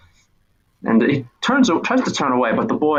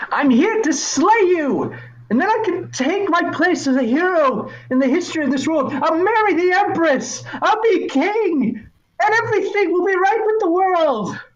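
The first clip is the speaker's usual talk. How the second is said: put-on much higher, earnest young boy voice; eager boy hero. Boy declaring to the figure, high and earnest. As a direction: puff up like a kid playing hero.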